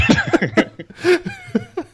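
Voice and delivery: high, wheezy laugh; laughter. Real, not acted. Host amused by cohost's ranking mistake, laughing hard. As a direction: keep cracking up.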